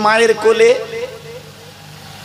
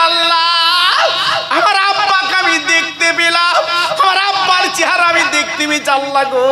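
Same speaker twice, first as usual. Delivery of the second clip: Prophet crying to God; 9 semitones higher than usual. Acted. Prophet thanks God at the grave, high tearful cry. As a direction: wail it up to God. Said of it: "হাত দুখানা তুলে কাঁদে".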